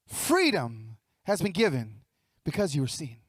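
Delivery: ordinary delivery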